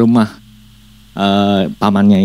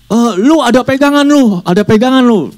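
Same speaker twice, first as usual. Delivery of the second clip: high, loud insistence; demon voice; 13 semitones higher, 4 dB louder than usual. Acted. Demon insisting the boy has an amulet, high loud. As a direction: accuse him firmly. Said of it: "dia bilang".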